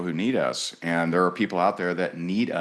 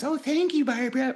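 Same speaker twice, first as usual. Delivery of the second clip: much higher, tiny meek voice; little guy voice. Retold or imitated. Salesman mocking himself before Barbara, squeaky and meek. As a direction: shrink into a squeaky little underling. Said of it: "I'm the little guy with little hands".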